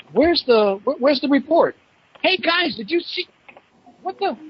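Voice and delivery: puzzled shout to the guys at the cannon; confused. Retold or imitated. Teen calling to hunting buddies, confused and rising.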